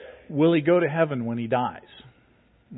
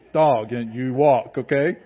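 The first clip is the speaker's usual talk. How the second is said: talking to deaf dog; 6 dB louder, slower than usual. Retold or imitated. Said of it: "we do sign language now".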